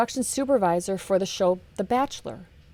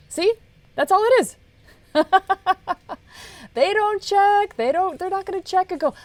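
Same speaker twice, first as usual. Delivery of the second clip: much higher and louder, laughing through it; 10 semitones higher, 8 dB louder than usual. Real, not acted. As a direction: burst out laughing at how simple it is. Laughs at how easy it is.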